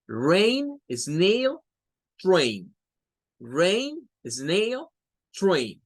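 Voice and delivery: "Rain, snail, train" as a list, rising, rising, falling; model pronunciation. Shown as a demonstration. Teacher drilling A changed to E, model pronunciation. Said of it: "así hágala sonar".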